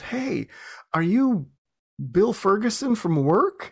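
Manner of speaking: high-pitched mock yell, no real volume; retold yell. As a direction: imitate someone calling out, pitched high and stretched. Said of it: "he yells at me".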